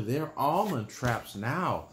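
plain reading voice

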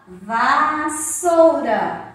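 VASSOURA very slow, va-ssou-ra; slow teacher's reading of VASSOURA, syllables drawn out; sounding out syllables. Shown as a demonstration. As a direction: stretch VASSOURA so the first syllable VA stands out. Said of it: "do lado da sílaba vá".

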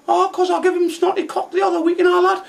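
very high mock girl voice; imitating Scruffy Lisa. Taxi driver mimicking his passenger in a very high mock voice. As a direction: squeak it out as the lass. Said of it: "She went".

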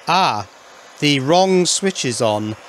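ordinary talk, nothing marked

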